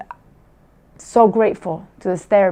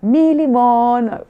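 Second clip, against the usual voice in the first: slow, higher teasing singsong; teasing sing-song; 4 semitones higher, much slower than usual. Retold or imitated. Mother imitates daughter teasing her twin, slow singsong. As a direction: drawl it out like a kid taunting. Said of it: "she starts teasing her sister".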